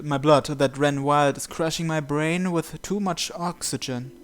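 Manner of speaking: normal talking voice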